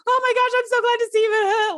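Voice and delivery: high, loud burst of joy and relief; overjoyed mock cry. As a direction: squeal it like a relieved mother. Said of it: "and not like".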